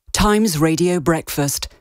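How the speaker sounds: usual speaking voice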